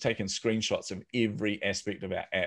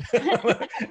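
high burst of laughter; real laugh; 13 semitones higher, 4 dB louder than usual. Real, not acted. Laughing at his own retold phone trick.

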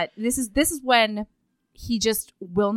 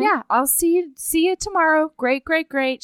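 higher, bright fake-polite brush-off; forced cheerful goodbye; 6 semitones higher than usual. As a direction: paper over the upset with a breezy see-you.

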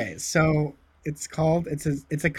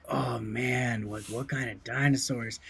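slow, low, spaced-out stoner; 4 semitones lower, 7 dB quieter, slower than usual. Retold or imitated. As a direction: drawl it out like a stoner. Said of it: "So then I put on a stoner voice".

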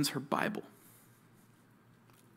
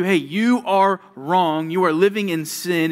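louder, slower, pointed rebuke; stern rebuke; 4 semitones higher, 7 dB louder than usual. Acted. God's word telling the listener they are wrong, a stern loud rebuke. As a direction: confront the sinner head on.

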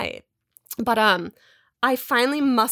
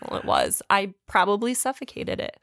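normal talking voice

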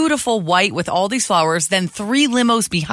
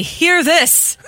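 town crier; 9 semitones higher than usual. Retold or imitated. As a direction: kid doing a town crier call. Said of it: "an eighteenth century town crier".

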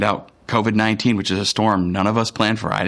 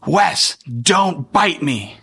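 higher-pitched, drawn-out protest; mock yell from downstairs; 5 semitones higher, much slower than usual. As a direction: call out an indignant complaint across the house. Voices child yelling from downstairs.

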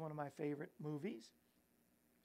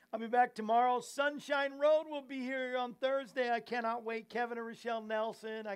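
excited announcement; 5 semitones higher, 9 dB louder than usual. Real, not acted.

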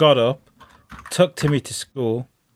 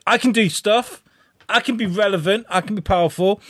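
higher-pitched, eager self-assertion; mock boasting; 8 semitones higher than usual. Theon insisting he can be relevant, mock boasting. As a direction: declare it like someone desperate to prove himself. Retold boast of a character taking over.

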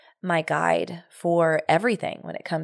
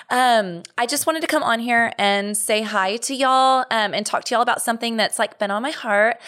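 high-pitched mock livestream host; Facebook Live impression; 5 semitones higher than usual. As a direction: parody a girly livestream intro, pitched high. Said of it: "My Facebook Live impression".

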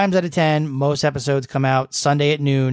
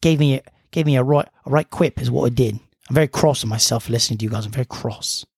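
put-on British listener complaining; British accent; faster than usual. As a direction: play a politely cross caller in British accent. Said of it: "That was British".

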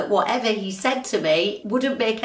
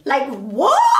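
loud, high incredulous cry; indignant what; 6 semitones higher, 4 dB louder than usual. Real, not acted. Complaining about the neighbour who shushes her, an indignant loud what. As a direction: throw it out in disbelief.

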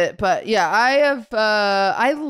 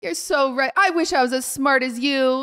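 higher, falsely admiring; condescending; 3 semitones higher than usual. Retold or imitated. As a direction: flatter him with mock sincerity. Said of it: "I'm being very condescending".

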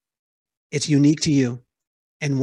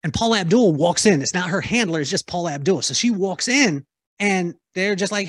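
ordinary talk, nothing marked

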